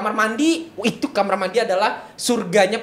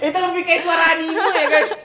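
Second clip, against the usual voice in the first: laughing, higher; 8 semitones higher than usual. Real, not acted. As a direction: laugh while explaining. Laughing, high.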